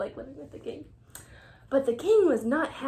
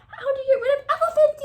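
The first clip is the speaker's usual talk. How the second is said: high, loud, fast yell; the fed-up king yells at his wise men, high and loud; 11 semitones higher, 8 dB louder, much faster than usual. Acted. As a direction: yell it in royal despair. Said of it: "yelled the king".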